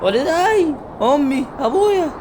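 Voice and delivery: high, loud joyful shout at the parents; overjoyed cry. Real, not acted. Gamer spotting the parents, a high excited shout. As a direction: call out to them in sudden delight.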